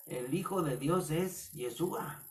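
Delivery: ordinary talk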